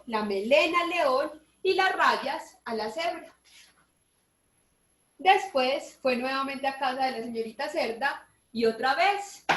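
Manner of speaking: usual speaking voice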